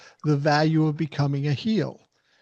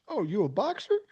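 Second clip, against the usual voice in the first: mock-impressed Ali; 6 semitones higher, 4 dB quieter than usual. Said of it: "Ali looks at him and goes".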